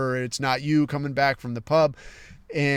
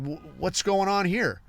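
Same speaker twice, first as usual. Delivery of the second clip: ordinary delivery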